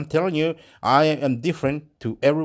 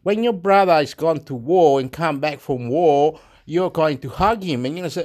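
usual speaking voice

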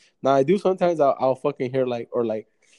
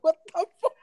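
startled; 5 dB louder than usual. Retold or imitated. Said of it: "Everybody's like".

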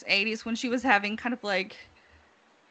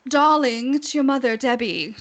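mock starlet greeting; imitating Debbie Reynolds; 3 semitones higher, 4 dB louder than usual. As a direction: play the theatrical old Hollywood mother. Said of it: "she'd be like".

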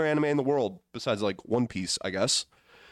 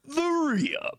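high, hyped show-name call; hype announcer. Acted. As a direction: belt out the podcast name.

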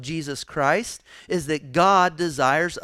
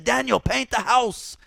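higher and louder barked order; commanding Miyagi; 10 semitones higher, 5 dB louder than usual. Retold or imitated. Preacher voicing Miyagi ordering Daniel mid-fight, loud and high. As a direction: call it out as he throws a punch. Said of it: "he said".

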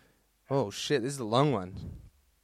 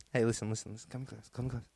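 much softer, drawing the listener in; confiding hush; 2 semitones lower, 8 dB quieter, faster than usual. Pretend. Beckons the listener in closer.

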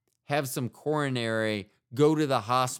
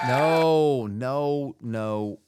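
sudden loud no; shouting no; 4 semitones higher, 8 dB louder, much slower than usual. Real, not acted. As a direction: blurt out a loud no. Sudden outburst of refusal.

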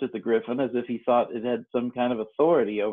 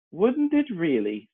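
surprised; 6 semitones higher, slower than usual. A narrator's reading. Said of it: "in a tone of great surprise".

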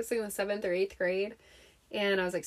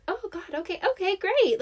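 flustered mom; 10 semitones higher, 4 dB louder than usual. Retold or imitated. Mom's flustered phone reply, retold.

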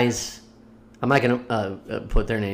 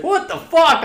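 high, loud retold yelp; mock shocked outburst; startled outrage; 5 dB louder than usual. Retold man startled on toilet.

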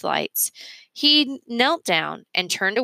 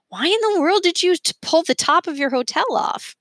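put-on high, astonished child's question; a kid who can't believe it; amazed question; 11 semitones higher than usual. Remy to Mergenser, high and amazed.

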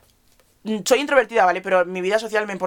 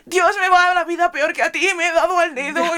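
high, loud hammed-up whine about a finger; mock whiny friend; 11 semitones higher, 6 dB louder than usual. Mocking a friend who whines about her finger to a friend with cancer. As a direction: play the self-pitying friend, way overdone.

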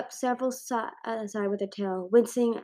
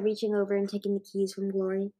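ordinary delivery, nothing marked

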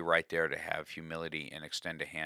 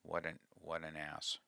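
much softer self-reproach, as if thinking; inner thought; 9 dB quieter than usual. Retold or imitated. Ashamed speaker thinking to himself in the arena, soft and self-reproaching. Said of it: "I'm thinking to myself".

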